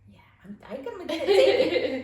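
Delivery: bursting out laughing while talking. Real, not acted. Laughing hard while telling the host about buying toys. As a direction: laugh the words out loud and high.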